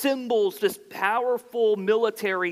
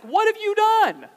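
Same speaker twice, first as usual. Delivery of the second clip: "What have you done" leaps much higher and louder; indignant high question; 11 semitones higher, 4 dB louder than usual. Retold or imitated. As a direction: fling the question up in accusation. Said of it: "notice how their question parallels".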